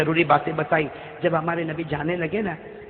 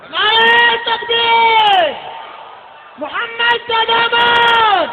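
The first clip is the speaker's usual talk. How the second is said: long, high, drawn-out slogan shout; rallying cry; 8 dB louder, much slower than usual. Real, not acted. Preacher leading the crowd in a slogan, rallying cry. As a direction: call out slowly and high to rouse everyone.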